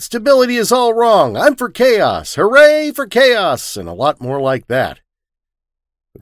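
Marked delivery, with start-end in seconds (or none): 0.0-3.8 much higher, loud rally shouting, slowing on the last cheer; loud, high chanting shout, drawn out at the end; shouted slogans. Acted. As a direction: bellow it so the controller hears. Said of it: "I also shouted".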